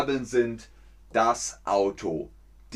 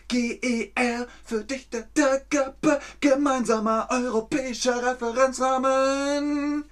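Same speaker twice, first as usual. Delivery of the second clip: high squeaky comic voice for the acronym; silly high mock voice spelling out the name; squeaky cartoon voice; 13 semitones higher than usual. Playful high funny voice.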